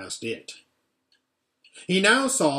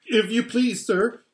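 trembling; 8 semitones higher than usual. A narrator's reading. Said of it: "trembling so that he could hardly speak".

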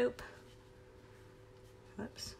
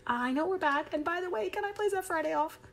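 a hint of sweet begging, higher; mock pleading teacher; 9 semitones higher than usual. Teacher imitating herself asking principal, mock pleading. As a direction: lightly play the teacher asking the boss. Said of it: "Try that one with your principal".

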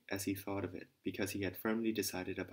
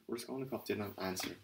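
usual speaking voice

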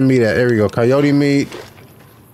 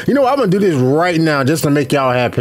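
normal talking voice

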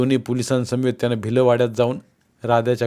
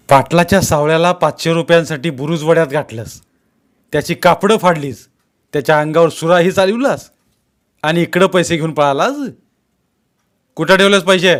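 loud, raised-pitch interrogation; angry accusation; 5 semitones higher, 7 dB louder than usual. A narrator's reading. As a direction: fire charges at the suspect, ending in a demand. Policeman accuses the suspect.

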